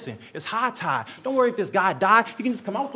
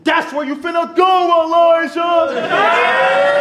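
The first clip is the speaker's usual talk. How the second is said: loud, drawn-out higher call; shouting; 5 semitones higher, 7 dB louder, much slower than usual. Acted. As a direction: stretch the name out, yelling. Calling out opponent's name.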